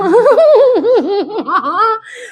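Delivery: high, gleeful gloating laugh; laughing at someone caught in a door. Real, not acted. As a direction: laugh at his bad luck. Laughs at the trapped bully.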